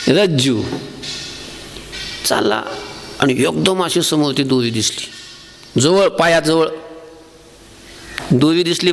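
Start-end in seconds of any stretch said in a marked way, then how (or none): none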